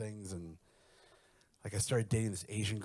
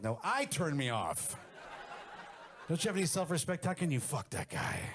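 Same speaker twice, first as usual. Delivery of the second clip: self-disgusted; 7 semitones higher than usual. Retold or imitated. Disgusted at his reflection.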